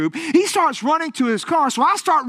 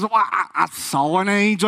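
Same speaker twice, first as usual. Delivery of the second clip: hesitant confession; 4 semitones lower than usual. Retold or imitated. Reluctant confession in retold exchange.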